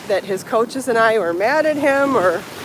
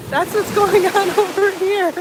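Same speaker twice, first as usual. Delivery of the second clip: laughing; 8 semitones higher than usual. Real, not acted. Nervous laugh after sad news.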